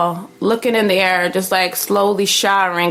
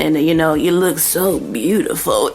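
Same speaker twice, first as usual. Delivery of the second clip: put-on old man voice; 5 semitones lower than usual. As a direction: drop the pitch like a grandpa sweet-talking. Voicing old man on the phone.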